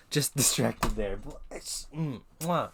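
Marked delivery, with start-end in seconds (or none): 1.9-2.7 appreciative hum, then a smacked kiss noise; chef's-kiss mwah. Acted. Streamer savouring a favourite accent for viewers, chef's-kiss mwah. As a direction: relish it with a raised, punchy "mwah".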